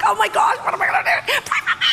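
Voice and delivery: high-pitched comic panic; hammed-up frantic babbling; mock freaking out. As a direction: re-enact a panicked meltdown. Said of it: "freaking out".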